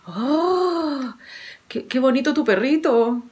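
suave y más baja de volumen; abuela tierna. A narrator's reading. (la abuela alaba al perrito, voz suave y tierna)

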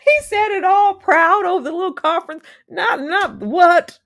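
high, loud, puffed-up retelling; mock proud voice. Recapping a TV character's proud boast, mock proud.

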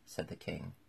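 ordinary delivery

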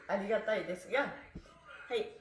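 ordinary talk, nothing marked

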